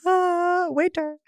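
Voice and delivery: high, hammed-up call for the waiter; fancy restaurant voice; mock posh diner. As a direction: summon the waiter like a snob. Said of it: "partake of your pecan pie".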